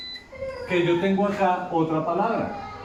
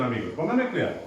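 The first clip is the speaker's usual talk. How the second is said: usual speaking voice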